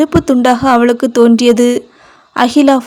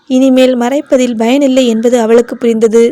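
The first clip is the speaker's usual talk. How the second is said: plain reading voice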